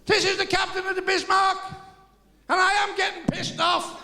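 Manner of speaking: high, loud, slow furious bellow; angry megaphone. Acted. Bismarck captain threatening torpedoes, furious through a megaphone. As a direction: threaten them, losing patience.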